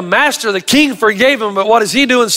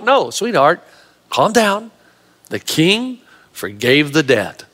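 calming husband; 5 semitones lower, 3 dB quieter, slower than usual. Acted. Husband calms panicking wife.

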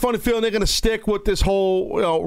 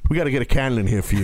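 usual speaking voice

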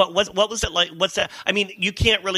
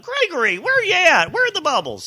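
calling out; 7 dB louder than usual. Retold or imitated. People calling out to host.

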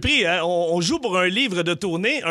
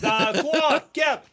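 high, rising count-in; excited countdown; 9 semitones higher, much slower than usual. Real, not acted. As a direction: count them in with hype. Host counts in the game.

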